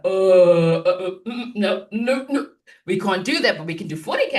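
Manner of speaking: hammed-up client recoiling at the number; imitating a client's alarmed no; panicky refusal. Client balks at a hundred K.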